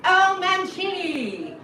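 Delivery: high, loud, slow drawn-out name call; announcer intro. Retold or imitated. Ethics officer introducing the teacher, slow loud announcer call. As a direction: announce him like a boxing ring host. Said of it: "And she's like".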